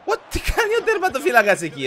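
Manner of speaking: loud, high-pitched disbelief; real shocked exclamation; incredulous outburst. Real, not acted. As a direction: cry out at the keeper's blunder. Goalkeeper blunder in game.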